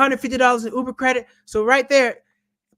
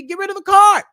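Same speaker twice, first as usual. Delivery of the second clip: shouted, higher: get rid of it; loud command; 11 semitones higher, 9 dB louder than usual. Real, not acted. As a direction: yell the order at the viewer. Ranting about annual fees.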